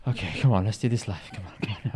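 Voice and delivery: whispered self-urging. Real, not acted. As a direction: whisper to yourself. Urging himself before the attempt.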